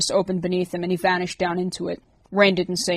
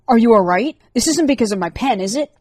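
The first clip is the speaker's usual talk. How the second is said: higher, louder anxious concern; worried questions; 4 semitones higher, 6 dB louder than usual. A narrator's reading. Liz worried about Rorik over the phone. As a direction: fret over him aloud.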